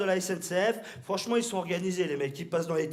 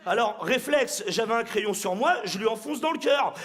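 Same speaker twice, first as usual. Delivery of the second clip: ordinary talk, nothing marked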